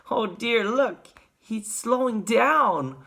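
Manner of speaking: put-on high, slow, concerned child; Charlie Bucket. A narrator's reading. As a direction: watch with worry, stretching the words. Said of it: "said Charlie Bucket".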